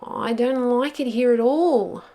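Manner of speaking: high, loud, fretful moaning; anxious moan. A narrator's reading. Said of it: "moaned Pog".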